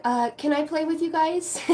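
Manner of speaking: put-on high, softly pleading kid voice; little kid. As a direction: ask like a little kid wanting to join in. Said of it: "Like a little kid on the".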